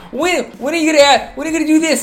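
high, nagging impatient imitation; whiny impatient nagging. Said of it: "You're like".

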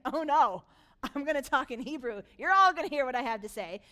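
loud, defiant acted shout; Sennacherib. Enemy king defying the leaders before the people, loudly shouted. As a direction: boom it out so everyone hears. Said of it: "And Sennacherib's like".

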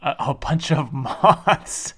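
slow, amused repeat through laughter; laughing. Real, not acted. Host repeating co-host's odd summary, laughing. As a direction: draw it out, breaking into a laugh.

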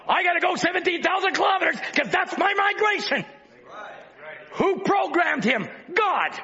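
fervent pulpit cry building on each line; preaching. Acted. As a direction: preach it out to the congregation. Preaching at full force.

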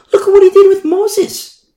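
excited. Real, not acted. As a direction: cry out in amazement at what God did. Preacher marvels at God's work.

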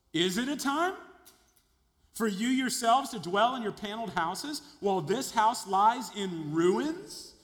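high, loud mocking question; sarcastic. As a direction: needle them with biting sarcasm. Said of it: "notice the sarcasm in God's response".

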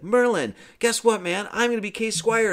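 put-on high-pitched boy bursting with news; imitated excitement. Arthur running up to tell Merlin, acted excitement. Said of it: "Arthur's all excited".

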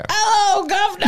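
high, hammed-up British greeting; mock British accent. Shown as a demonstration. Cohost answering a request for British, high hammed-up British accent. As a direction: belt out a cartoon British hello, laughing. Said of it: "can you do a British".

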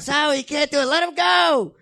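acted outburst from a film scene; imitated desperate shout. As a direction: cry out the plea, pitch climbing way up. Acted movie quote, pleading shout.

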